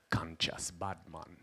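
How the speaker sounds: slow hushed whisper; low whisper. Real, not acted. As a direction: breathe the words out like a secret. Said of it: "whispered punchline".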